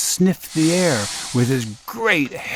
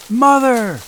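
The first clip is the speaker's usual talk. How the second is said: high, loud shout; shouting for mother; 12 semitones higher, 6 dB louder than usual. Acted. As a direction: call her from down the beanstalk. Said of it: "Jack shouted".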